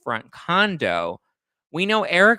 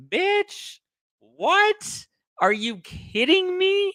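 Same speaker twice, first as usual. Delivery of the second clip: much higher, shocked disbelief; incredulous shriek; much slower than usual. Real, not acted. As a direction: react like it's outrageous. Outraged reaction to quote.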